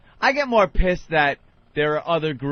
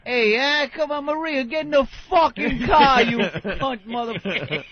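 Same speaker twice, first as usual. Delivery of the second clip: put-on Italian-American accent; 6 semitones higher than usual. Italian guy yelling at Maria.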